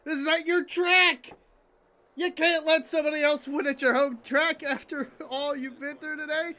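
rising from firm protest to high, loud plea; real fan desperation, climbing in pitch; desperate pleading. Real, not acted. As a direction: plead with your driver, building as you go. Pleading with his driver on screen.

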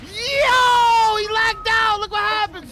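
high loud excited shout. Real, not acted. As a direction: yell it out. Car lags and crashes.

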